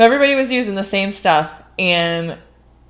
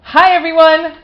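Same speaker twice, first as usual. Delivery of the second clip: excited greeting; 9 semitones higher, 8 dB louder than usual. Real, not acted. Podcast opening greeting.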